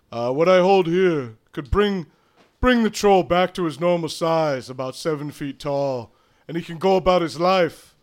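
loud syrupy servant; assistant voice. Acted.

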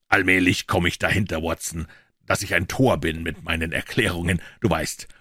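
plain reading voice

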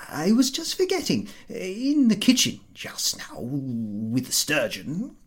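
high, hushed, chuckling over-explanation; nervous host. A narrator's reading. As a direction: laugh it off too eagerly. Ahiniv nervously explaining to Pardacqua.

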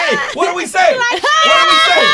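hammed-up high yelling chant; mock shout. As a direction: yell it like an excited crowd leader, playfully. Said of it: "And they're like".

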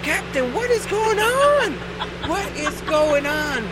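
high, slow, alarmed crew voice; panicked crew. Retold or imitated. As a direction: plead with the captain in alarm. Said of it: "And we're like".

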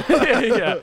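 ordinary talk, nothing marked

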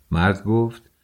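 ordinary delivery

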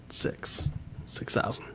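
whispered price, barely audible. Retold or imitated. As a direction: lean in and whisper the number. Bartender quoting bottle price.

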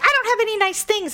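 high, quick teen whine, lightly voiced; a hint of a pouty teenager; mock whiny daughter. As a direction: imitate a sulky daughter complaining. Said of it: "And Mackenzie's like".

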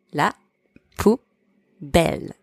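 poubelle drawn out slowly; slow teaching model of La poubelle; slow split demo. Shown as a demonstration. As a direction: break La poubelle apart and stretch it. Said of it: "Repeat after me".

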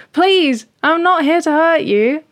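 high, loud frightened plea; freaking-out cat. Acted. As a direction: plead in a panic as a scared little cat. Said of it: "Speckle starts freaking out".

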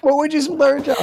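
high, loud comeback question; amused challenge. Real, not acted. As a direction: toss the question back loudly, pitched right up. Guest throws question back, amused.

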